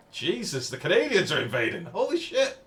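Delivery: loud, high-pitched astonishment; mock alarm. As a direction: cry out as if under playful invasion. More Canadians turn up in chat.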